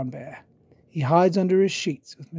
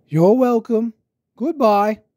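doctor's goodbye; 6 semitones higher, 6 dB louder than usual. Acted. Doctor Brown Bear leaving.

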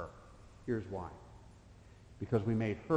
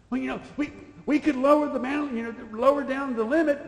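much higher, louder whiny salesman voice; high mock customer-service whine; mocking company rep; 10 dB louder than usual. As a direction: mimic the pushy rep in a high simpering voice. Mimicking credit card company rep.

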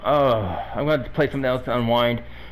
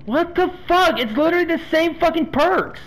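exasperated outrage; 13 semitones higher than usual. Real, not acted. Streamer rages at identical survivor perks, high furious disbelief. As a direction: squeal it out in outrage. Raging again at same perks.

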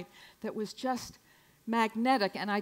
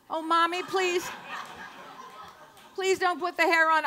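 put-on child pleading; 7 semitones higher than usual. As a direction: beg Mommy like a small child.

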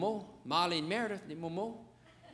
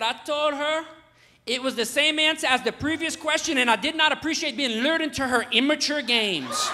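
higher, louder mock outrage; hammed-up indignation, pitched up; indignant little boy; 6 semitones higher, 4 dB louder than usual. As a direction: imitate an offended child making a formal protest. Retells mad son's indignant answer.

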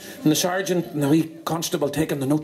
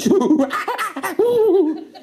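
high hoots and screeches; monkey chatter; 13 semitones higher, 3 dB louder than usual. Acted. Monkey confirming the smoking guess, high chattering. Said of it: "The monkey says".

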